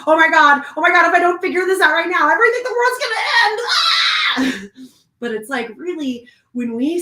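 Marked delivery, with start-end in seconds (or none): 0.1-4.9 higher and louder, rushing into a mock shriek; hammed-up panicking, fast and shrill-rising; mock panic. Imitating a stressed person to viewers, mock panic. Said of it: "we feel like".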